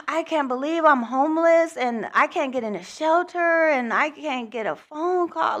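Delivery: high, distraught outpouring of despair; losing it after being turned away from the shelter; near-tears despair, pitched high; retold despair. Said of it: "I was just losing it".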